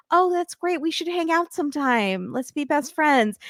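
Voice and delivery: high, sugary mock friendliness; fake friendly. Castmate faking friendship backstage, high and sugary. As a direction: gush with fake niceness. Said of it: "I'm going to be like".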